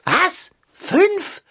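as if the number were a disaster; aghast, pitch jumping up on Fünf; shocked disbelief. Acted. Cat shocked at sausage count.

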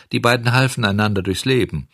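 usual speaking voice

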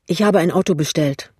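plain reading voice

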